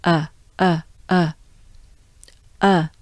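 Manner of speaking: uh with the jaw forward; French eu. Shown as a demonstration. Teacher drilling the eu vowel. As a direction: round eu sound, jaw out. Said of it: "you move your jaws forward".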